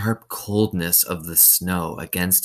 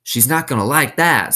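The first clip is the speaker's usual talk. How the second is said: higher, louder worried counselor; Pendanski voice; 7 semitones higher, 6 dB louder than usual. A narrator's reading. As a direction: warn it won't go over well. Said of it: "said Mister Pendanski".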